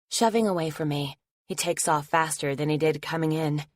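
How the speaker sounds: ordinary delivery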